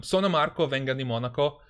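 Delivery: playful put-on Italian accent. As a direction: say it like an Italian, hammed up. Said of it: "I can only say".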